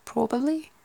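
high, loud mock Scottish; hammed-up Scottish, rising at the end; attempted Scottish accent. Shown as a demonstration. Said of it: "that'd be more Scottish".

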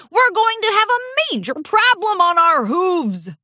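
put-on high, anxious old pony; frantic old stallion. A narrator's reading. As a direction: list them in growing alarm.

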